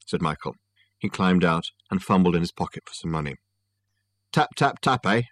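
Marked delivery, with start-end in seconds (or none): 4.3-5.2 higher, voiced mock tapping; cab driver. A narrator's reading. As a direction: needle the passenger as a cabbie. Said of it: "said the cab driver".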